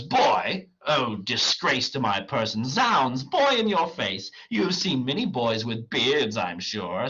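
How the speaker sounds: theatrically indignant, pitch leaping; affronted. Acted. Clown objects to being called boy, affronted. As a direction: protest the insult with comic outrage.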